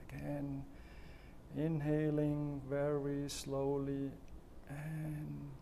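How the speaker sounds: slow, soft breathing-exercise guidance with long pauses; guided breathing. Shown as a demonstration. Coach leading viewers through slow breathing, calm and soft.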